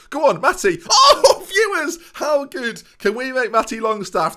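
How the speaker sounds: giddy laughing celebration, way up high; laughing cheer. Real, not acted. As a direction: burst out laughing at the goal. Wild goal celebration, laughing.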